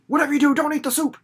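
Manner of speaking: fast, high urgent plea; panicked fast warning from an intruder. Pretend. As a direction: rush the warning out in alarm. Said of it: "'cause somebody".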